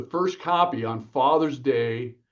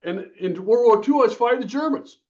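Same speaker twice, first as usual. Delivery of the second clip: lightly voiced, higher old father reminiscing; a hint of an old fighter pilot dad remembering the war, pitched up; retold old dad; 8 semitones higher, 3 dB louder than usual. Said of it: "And he goes".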